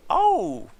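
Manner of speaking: high Mario-style "Oh!". Retold or imitated. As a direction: yelp it like Mario. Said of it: "quote Charles Martinet".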